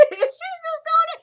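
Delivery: real high, loud laughter; cracking up while talking; words squeezed out through laughter; laughing. Real, not acted. Breaks up laughing at the retelling.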